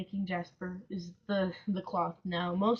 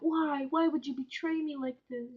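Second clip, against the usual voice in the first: lightly voiced betrayed doll, slightly higher; doll's voice; 6 semitones higher than usual. The doll pleading with her as she paints it, mild betrayal. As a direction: plead like a hurt doll, only a little put on. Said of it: "the doll's going like".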